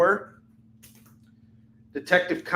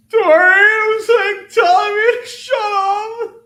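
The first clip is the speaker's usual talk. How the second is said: very high, loud hammed-up wail, slow; mock sobbing; 11 dB louder, slower than usual. He mock-complains that Tori told him to shut up, a hammed-up high wail. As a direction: blubber it like a kid tattling.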